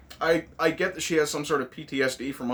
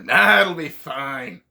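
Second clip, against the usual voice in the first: put-on Scottish accent; 6 dB louder, much slower than usual. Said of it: "I can't really do a Scottish accent".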